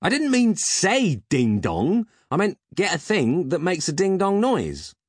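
high, loud exasperated rant. A narrator's reading. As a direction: berate the butler, freshly startled. Startled Peg berates his butler.

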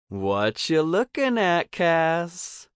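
high, teasing sing-song; playground-style sing-song taunt. A narrator's reading. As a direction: chant it high to tease someone caught looking. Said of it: "asks in a sing-song voice".

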